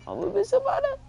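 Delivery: high-pitched mock whine, Hibana; squeaky high voice. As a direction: squeak it out like a whiny kid. Repeats in high put-on voice.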